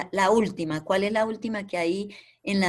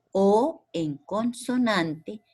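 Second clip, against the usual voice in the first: drawn-out explanation; 2 semitones higher, much slower than usual. Shown as a demonstration. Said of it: "teacher stretching rule".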